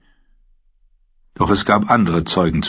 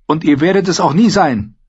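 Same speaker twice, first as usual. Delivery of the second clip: a higher, defiant retort; 10 semitones higher, 4 dB louder than usual. A narrator's reading. Sansa to King Joffrey, defiantly contradicting. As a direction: throw the denial back at him. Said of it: "entgegnete sie trotzig".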